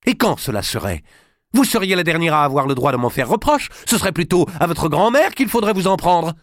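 a king losing his temper; angry retort. Acted. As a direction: lash back in anger. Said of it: "La colère chez Louis".

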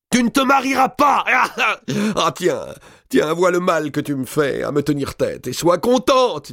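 put-on high, loud shrill old woman's shriek; the countess screams at her maid, gasping; furious countess. A narrator's reading. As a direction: rage at the defiant maid. Enraged countess shouts.

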